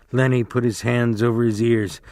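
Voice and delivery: usual speaking voice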